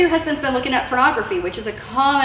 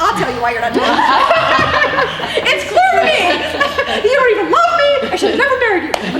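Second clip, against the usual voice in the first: high, loud, laughing mock tirade of a wife; hammed-up accusing wife, laughing; mocking nagging wife; 12 semitones higher, 6 dB louder than usual. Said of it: "women, like, pause, "Oh, we gotta fill it with words".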